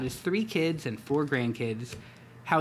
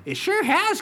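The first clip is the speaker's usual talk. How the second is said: much higher, louder youthful Hobbs; youthful tiger voice; 7 dB louder than usual. Acted. Tiger to old friend, high youthful voice. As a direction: bounce it like a young tiger.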